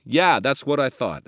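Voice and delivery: ordinary delivery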